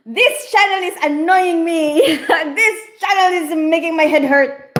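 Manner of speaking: high, loud mock complaint, a touch of whine; hammed-up moaning of a fussy viewer; mocking whiny viewer. Streamer mocking a complaining viewer, high mock whine. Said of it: "instead of just doing the one second one".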